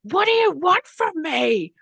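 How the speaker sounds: high, loud, slow cry of despair; desperate scream. Retold or imitated. Retelling screaming at the sun, loud desperate scream. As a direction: scream it at the sky, pleading. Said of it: "I started screaming at the sun".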